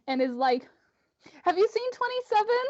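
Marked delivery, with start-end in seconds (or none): 1.4-2.6 put-on high, frightened little-girl voice; scared child. Frightened girl asking Kriv directly, high scared child voice. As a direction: ask it high, as a scared kid. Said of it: "immediately gets super scared".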